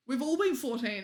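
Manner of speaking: ordinary delivery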